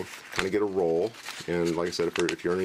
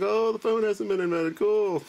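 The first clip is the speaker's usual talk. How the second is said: much higher squeaky cartoon voice; Andrew Jackson realizing; 3 dB louder than usual. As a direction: shrug it off in a squeaky silly voice. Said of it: "It's like".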